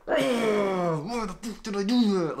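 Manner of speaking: high, slow, strained growl of self-directed rage; clenched-mouth anger. Shown as a demonstration. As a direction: force it through a clenched mouth, furious at yourself. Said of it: "mouth clenched. I literally had that reaction".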